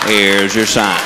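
low deadpan tag line; catchphrase punchline. Acted. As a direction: drop the voice way down and land it.

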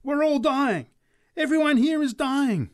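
very high, loud screamed warning; a preacher screaming a dire prophecy. Acted. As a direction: scream the doom at everyone. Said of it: "she raised her voice to a scream".

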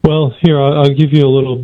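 usual speaking voice

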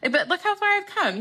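high-pitched mock self-congratulation, laughing; playful echo. Guest echoing host's line back, high and playful.

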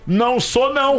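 high, indignant imitation of a denial. Guest re-enacts the TV video, high mock-indignant denial. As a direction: mimic an affronted man snapping back a denial. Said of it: "aí o primeiro vídeo que eu peguei é aquele".

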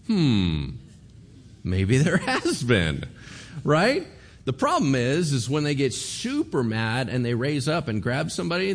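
usual speaking voice

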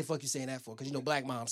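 low, soft mutter of the line; muttered under breath. Shown as a demonstration. Comic retelling to the crowd, a quiet muttered aside. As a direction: say it where mom can't hear. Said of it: "I didn't say".

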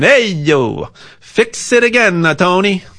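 jokey Italian accent, swooping up and down. Shown as a demonstration. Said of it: "I'll say it with an Italian accent".

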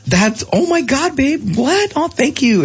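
high, drawn-out squeals of delight; overjoyed high-pitched imitation of his wife; excited wife. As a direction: gush like she can't believe it. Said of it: "She's like".

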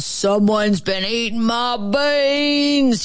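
slow, rough growling bear voice; big, rough, gruff growl; great big gruff bear. A narrator's reading. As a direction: growl it out slowly like a riled grizzly. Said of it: "great big rough gruff voice".

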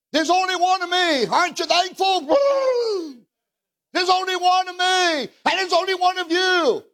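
high, ringing preacher emphasis; fervent sermon line; emphatic preaching. Real, not acted. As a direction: drive the point home.

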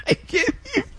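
high squeal of laughter, words barely out; losing it mid-ad; laughing. Real, not acted. Host breaking up on air, laughing.

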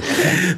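short high-pitched laugh. Real, not acted. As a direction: burst into a brief real laugh. Laughs as they give up.

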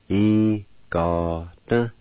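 "i-ga-ta" very slow, each syllable apart; syllable-by-syllable demo. Shown as a demonstration. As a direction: stretch out "i-ga-ta" for learners. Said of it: "Second part".

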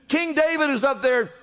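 ordinary delivery

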